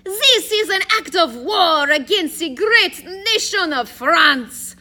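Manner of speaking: high, loud, indignant fake French accent; outraged mock Frenchman; questionable French accent. A narrator's reading. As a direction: protest slowly in a hammed-up French accent. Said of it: "in his questionable French accent".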